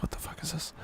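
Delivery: whispered, under the breath; baffled whisper. Retold or imitated. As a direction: hush it like you're spotting something odd. Retelling own baffled reaction.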